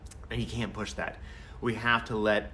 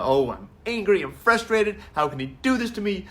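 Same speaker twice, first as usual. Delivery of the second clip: higher, louder imitation of a frustrated dumped teen; mock angry teen; 8 semitones higher, 4 dB louder than usual. Dumped daughter venting at the boyfriend, mock angry and high. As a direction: act out the teen's anger, a bit hammed-up. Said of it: "The anger".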